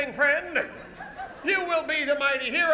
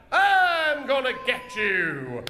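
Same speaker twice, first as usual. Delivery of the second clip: slow, drawn-out villain threat; villain's yell; much slower than usual. Shown as a demonstration. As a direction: yell it out slowly like a stage villain. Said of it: "and yell out".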